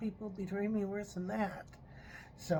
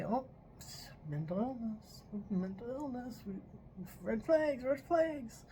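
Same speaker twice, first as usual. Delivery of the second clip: mocking the police; 4 semitones higher, 3 dB quieter than usual. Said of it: "they look at me and they say".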